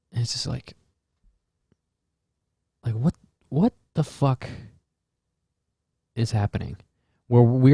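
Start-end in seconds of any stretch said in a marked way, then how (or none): none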